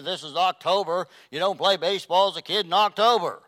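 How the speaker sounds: loud, rising preacher's rant; preaching at full force. Acted. Pastor rants to the congregation about October baseball, loud and forceful. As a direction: hammer it home from the pulpit.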